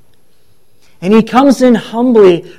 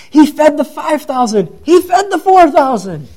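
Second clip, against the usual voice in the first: much higher, excited crowd voice; crowd's excited acclaim; 8 semitones higher than usual. Acted. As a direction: exclaim each miracle high, like an onlooker. Voicing the crowd recalling miracles.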